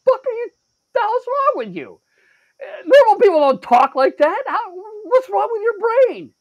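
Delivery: very high, indignant outburst; outraged rant. Retold or imitated. Shopper berating the stranger in line, outraged.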